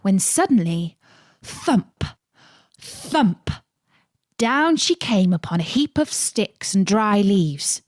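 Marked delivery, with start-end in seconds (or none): none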